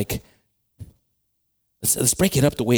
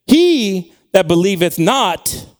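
loud, slow, raised proclamation; emphatic scripture reading; 5 semitones higher, 6 dB louder, much slower than usual. Real, not acted. Preacher reading the verse's warning to the church, emphatic proclamation. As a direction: declaim it with long pauses before the hard words.

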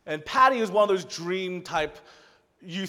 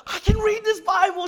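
high, loud thrilled cry, imitating her; so excited; 8 dB louder than usual. Girl handed a Bible she can read, imitated as wildly excited. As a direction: burst out in mock-squealing delight. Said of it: "She was so excited".

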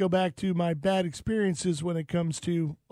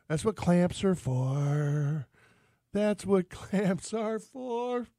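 slow, stretched-out punchline; drawn-out refusal; 3 dB quieter, much slower than usual. Acted. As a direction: drag each word out pointedly.

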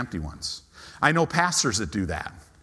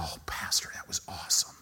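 soft unvoiced whisper; whispered empty seat; 9 semitones lower, 10 dB quieter than usual. Pastor voicing an empty seat, whispered. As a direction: breathe it like a tiny ghostly voice. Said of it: "I've never had an empty seat go".

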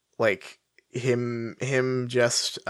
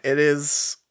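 ordinary talk

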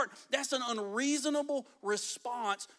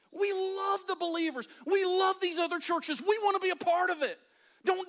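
begging; 7 semitones higher, 4 dB louder than usual. Acted. Macedonian church begs Paul to let them give, high impassioned pleading. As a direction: plead loudly and urgently to be let in. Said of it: "they begged".

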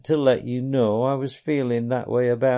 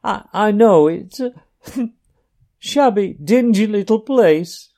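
high, loud, broken sobbing pleas; sobbing brokenly; 11 semitones higher, 8 dB louder than usual. A narrator's reading. Mole to Ratty, longing for his lost home, sobbing brokenly. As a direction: choke the words out between sobs. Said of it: "he sobbed forth at last brokenly".

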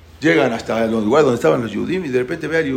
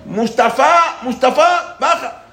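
high, loud shout up to a neighbour; calling out; 9 semitones higher, 4 dB louder, much slower than usual. Acted. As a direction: yell for someone to come down. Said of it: "le grita". (llamando al vecino a gritos)

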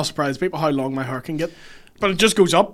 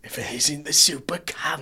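hushed, whispery Cockney-Australian growl; mocking whispered impression; Billy Butcher; 3 dB quieter than usual. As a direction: whisper it as a bad screen Cockney. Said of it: "his Billy Butcher's voice".

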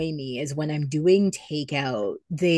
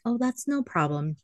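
higher, sing-out customer-service politeness; forced smile; 6 semitones higher than usual. Retold or imitated. Waitress retelling her reply to a non-tipper, fake cheerful. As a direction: say it through a put-on smile. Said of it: "give them a smile and be like".

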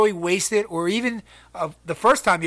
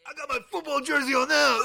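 ordinary delivery

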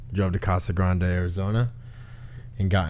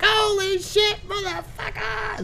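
much higher, strained yelling while falling; retold skydiving panic, yelled very high; mock freefall scream; much slower than usual. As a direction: scream the curses as if plunging through the sky. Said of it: "I was just cussing the whole way down".

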